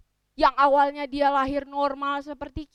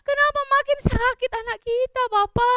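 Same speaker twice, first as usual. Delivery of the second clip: high, loud wailing plea; hammed-up tearful panic, stretched cries; panicked mother; 12 semitones higher, 10 dB louder than usual. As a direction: wail for help like a frantic mother. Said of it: "ibunya makin panik".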